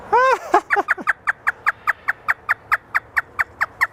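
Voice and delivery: very high shrieking cackle; hysterical laugh. Pretend. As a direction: cackle like a crazed bird. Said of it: "How about your new laugh? Is it hysterical?".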